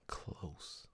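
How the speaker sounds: whispered close. Real, not acted. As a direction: breathe the word close. Whispered word.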